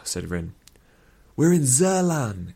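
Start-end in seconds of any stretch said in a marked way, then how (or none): 1.4-2.5 high, slow, eager cry; excited exclamation. A narrator's reading. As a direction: blurt it out with delight. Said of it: "exclaimed Elran".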